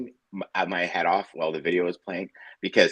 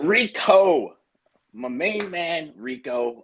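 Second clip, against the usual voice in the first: hearty hailing; 7 semitones higher, much slower than usual. Real, not acted. Host welcoming the guest, big drawn-out hail. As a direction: call out to a favorite buddy, stretching the words.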